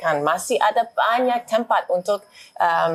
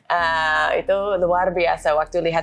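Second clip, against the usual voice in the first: foreigner speaking Indonesian. Real, not acted. American diplomat speaking Indonesian.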